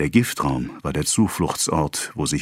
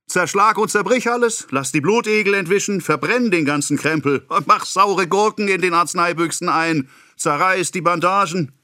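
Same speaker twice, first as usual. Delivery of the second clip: much higher, ranting and mocking; sarcastic tirade; 10 semitones higher than usual. A narrator's reading. Pharmacist mocking his apprentice, higher and furious. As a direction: throw the permissions at him in scorn.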